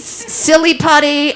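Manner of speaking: usual speaking voice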